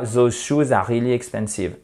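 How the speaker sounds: quick French-accented English, "those" as "zo"; French accent with even, unstressed syllables. Shown as a demonstration. Teacher showing learners the mistake, in a French accent. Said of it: "je vous donne la prononciation en français".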